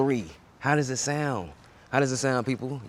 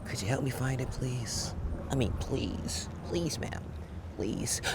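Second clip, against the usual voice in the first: pleading whisper; 4 semitones higher, 8 dB quieter than usual. Acted. Begging the librarian, hushed.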